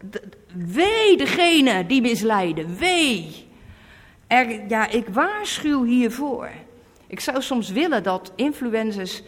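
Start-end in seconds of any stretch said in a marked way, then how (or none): none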